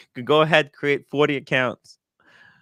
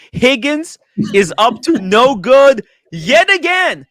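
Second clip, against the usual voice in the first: dramatic exposé; 10 semitones higher, 8 dB louder, slower than usual. Acted.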